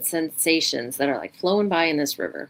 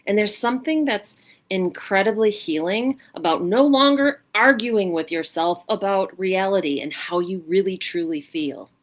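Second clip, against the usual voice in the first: normal talking voice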